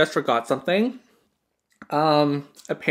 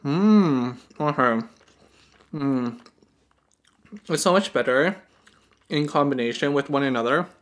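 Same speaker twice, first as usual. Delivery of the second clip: talking while chewing; chewy rice cake in mouth; mouth full. Real, not acted. Seen 3: Bites rice cake at -4, chews with closed lips while talking.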